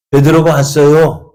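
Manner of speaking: acted girl bursting with news; excited announcement. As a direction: call it out to the whole room. Girl runs in announcing Peter.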